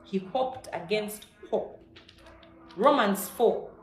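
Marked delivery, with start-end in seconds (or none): 0.0-1.8 slow emphasis. Acted. Preacher dwells on hoped against hope.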